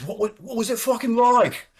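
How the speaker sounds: high, loud, eager questioning voice; excited English kid. Retold or imitated. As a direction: imitate a wide-eyed young refueler stammering with curiosity. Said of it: "English kid who was a naturalized American".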